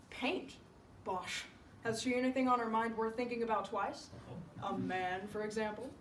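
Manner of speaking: lightly voiced doctor, scoffing then probing; scoff, then question in a lightly put-on voice; dismissive doctor. Doctor questioning Sue, mildly dismissive.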